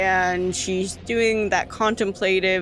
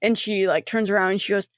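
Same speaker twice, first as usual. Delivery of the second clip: ordinary talk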